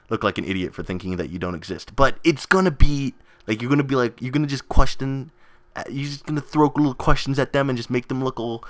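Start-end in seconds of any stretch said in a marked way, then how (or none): none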